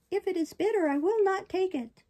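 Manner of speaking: high, loud puppet voice; balky puppet. Acted. Puppet refusing the fairy's medicine, balky.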